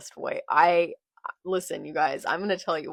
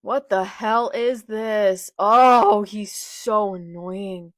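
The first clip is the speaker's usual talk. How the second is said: loud, slow, drawn-out exasperation; pissed off; 3 semitones higher, 10 dB louder, much slower than usual. Real, not acted. Fan annoyed at Blackbeard's arrival, pissed off. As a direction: groan it out loudly, fed up.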